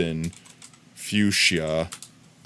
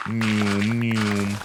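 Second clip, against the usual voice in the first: ordinary talk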